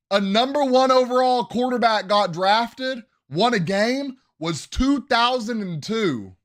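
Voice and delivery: high, loud, thrilled hype for the rookie; hyped up. Real, not acted. Fan hyping Caleb Williams's first win, loud and thrilled. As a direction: shout it out like a fan celebrating.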